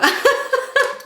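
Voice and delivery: sudden high-pitched giggle; high burst of laughter. Real, not acted. Laughing at her failure.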